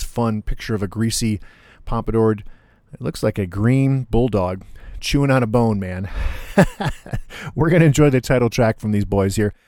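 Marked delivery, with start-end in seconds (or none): 6.1-7.5 breathy chuckle. Real, not acted. Laughs at cartoon album cover.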